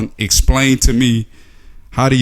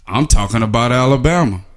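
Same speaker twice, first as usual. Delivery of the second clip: ordinary talk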